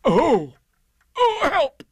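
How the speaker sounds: high, loud yelp for help; alarmed cry. Acted. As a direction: cry out as you get stuck. Pooh gets stuck climbing out.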